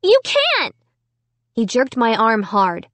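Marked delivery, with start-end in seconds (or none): 0.0-0.7 very high-pitched cry; shrieking. A narrator's reading. Child protesting to the evil dummy, shrieking. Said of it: "I shrieked".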